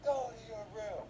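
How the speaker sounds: high, lightly voiced protest; a hint of a whining son cut off; whiny kid then mom. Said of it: "Ben's like".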